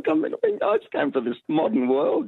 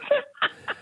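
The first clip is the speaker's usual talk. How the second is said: breathless laugh. Real, not acted. Caller laughing after answer.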